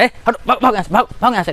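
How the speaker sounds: high mock shout of a driver; driver shooing boy. Driver chases boy away. (Driver की नकली डांट)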